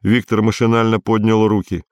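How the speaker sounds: plain reading voice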